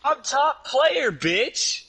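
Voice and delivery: high, slow taunting brag; cocky trash talk. Real, not acted. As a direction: gloat loudly and slowly.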